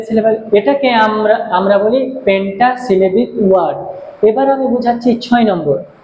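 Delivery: ordinary talk, nothing marked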